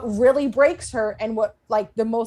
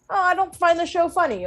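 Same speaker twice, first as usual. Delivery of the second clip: lightly mocking higher voice; hint of a higher-voiced complainer; mock critic; 8 semitones higher than usual. Podcaster imitating critics' mild complaints about the show, mock critic.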